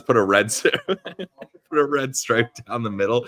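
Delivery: reading out while cracking up; real laughing. Real, not acted. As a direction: try to read it through laughter. Cracking up reading chat message.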